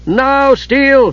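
high, loud battle shout; rally the fighters with a yell; urgent shouted order; shouted command. Acted. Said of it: "Palin shouted".

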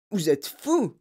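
mildly voiced indignant shriek; outraged cry. A narrator's reading. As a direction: cry out, scandalised. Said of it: "hurla une dame".

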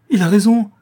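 high, loud cry of despair. A narrator's reading. The countess cries out in despair to Faublas. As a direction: she cries out, broken by despair. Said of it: "s'écria-t-elle avec désespoir".

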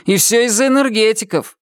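complaining. A narrator's reading. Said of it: "пожаловался костюм Джареду".

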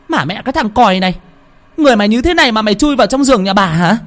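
high, fast scolding shriek; shrieking wife. Acted. Furious wife shrieks at her mud-covered husband, high and fast. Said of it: "chị vợ mới rú lên".

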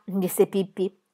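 usual speaking voice

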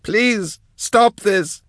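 very high pleading wail; desperate high-pitched plea. A narrator's reading. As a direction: beg in a piercingly high wail. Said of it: "she wailed".

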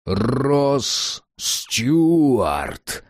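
booming announcement of the full name; grand entrance announcement. Acted. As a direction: announce him like a herald. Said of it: "громогласно объявил чужой мощный голос".

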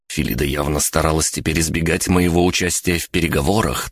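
usual speaking voice

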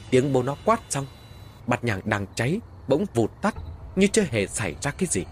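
ordinary delivery, nothing marked

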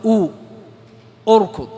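"أو، اركض" opening on a clear "u"; hamza with damma. Shown as a demonstration. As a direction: show the u vowel alone, then "اركض" as "urkud". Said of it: "إذا نضم همزة الوصل فنقول". (نطق "اركض" بهمزة مضمومة في أولها)

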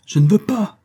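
high, loud angry refusal; enraged cry; furious shout. A narrator's reading. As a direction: burst out in protest, pitched right up. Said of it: "cria rageusement James".

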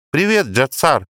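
put-on high bright girl greeting; girl's voice. A narrator's reading. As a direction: call out like a young girl. (звонкий девичий голос)

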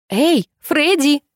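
high, drawn-out call; acted call to someone asleep; waking call. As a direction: rouse a sleeper with a slow, high call. Shaking a sleeper awake.

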